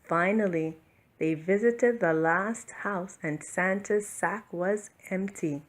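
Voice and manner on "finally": ordinary delivery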